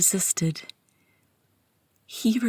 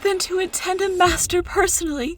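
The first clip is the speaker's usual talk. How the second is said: rapturous ecstasy; 8 semitones higher than usual. Acted. Enthralled servant kneeling before his master, higher ecstatic near-tearful voice. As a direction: voice climbing much higher in trembling rapture, near tears. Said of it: "I quiver with anticipation".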